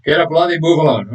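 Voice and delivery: hammed-up impatient outburst; angry impatience. Shown as a demonstration. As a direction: hurry the driver along, fed up. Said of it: "unless you're extremely angry".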